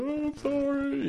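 imitating a boy's wailing cry; high, slow, drawn-out mock sob; crying apology. First-grader bursting into tears as he apologises, crying. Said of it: "he just starts crying".

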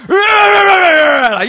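mock yell. Mimicking the ajashi's outburst.

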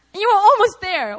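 urging encouragement. Acted. Jesus to Peter who almost made it, loud encouragement. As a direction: urge him on emphatically. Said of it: "I really believe that it's not true".

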